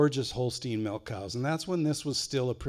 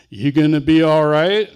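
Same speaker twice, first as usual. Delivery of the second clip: much louder, laughing as he asks; loud mock concern cracking into laughter; brother's revenge line; 3 semitones higher, 12 dB louder than usual.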